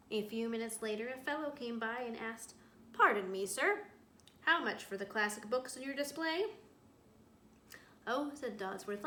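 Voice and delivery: reading a story aloud to a class; storyteller voice for young listeners; picture book read-aloud. A narrator's reading. Teacher reads picture book aloud.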